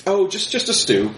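high, sweet hostess voice; wife's invitation. Acted. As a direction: invite him warmly, voice lifted high. Wife answers guest.

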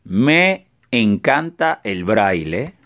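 slow, deliberate dictation; dictating. Shown as a demonstration. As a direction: spell it out slowly for typing. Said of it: "y vamos a poner".

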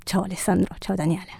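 soft whispered goodbye; whispering. Pretend. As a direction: whisper the names tenderly.

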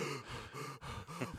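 sharp shocked gasp for air; retold breathless gasp. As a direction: gasp as if winded. After being knocked over.

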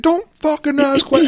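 dad barking at panicking son; retold angry yell. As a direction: cut him off furiously mid-word. Dad yells while doing Heimlich.

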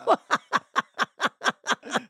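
high, open burst of laughter; hearty laugh. Real, not acted. Laughing at his own story.

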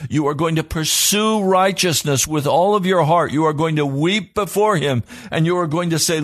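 high, driving pulpit voice, each line pressed harder; fervent sermon delivery building through a list of demands; full-force preaching. Acted. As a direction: preach it like a revival call. Preaching to the congregation.

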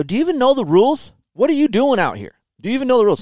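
furious at a rider on the track, yelling; pissed off. Retold or imitated. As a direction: yell at him in heated disbelief. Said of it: "And I'm pissed".

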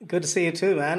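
ordinary talk, nothing marked

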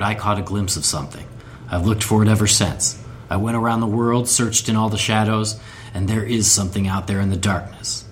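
low, brooding Batman growl, pitched well down; a dark vigilante confessing his obsession; Bruce Wayne voice. Acted. Cohost asked to read the teaser line as Bruce Wayne, low and brooding. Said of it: "I want you to do your Bruce Wayne".

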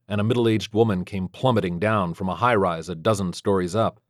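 plain reading voice

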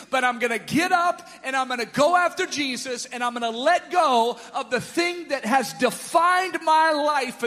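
loud, building declaration of hope; beggar's rising cry. Acted. Beggar throwing off his garment, building loud declaration.

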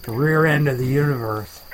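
ordinary talk

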